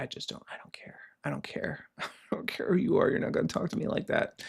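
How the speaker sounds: hushed, fast whisper; defiant under-the-breath whisper; whispered defiance. Real, not acted. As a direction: whisper it like a private vow.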